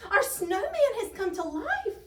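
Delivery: high, loud alarmed cry; panicked shriek. A narrator's reading. Tiny and gang fleeing the snowman, high shriek. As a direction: shriek the terrible news. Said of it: "shrieked Tiny and his gang".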